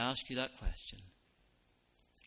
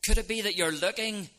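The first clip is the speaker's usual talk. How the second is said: raised, pleading appeal in a higher voice; urgent appeal; 7 semitones higher, 5 dB louder than usual. Acted. Preacher urging the congregation, louder and higher. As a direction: press the question home, louder and higher.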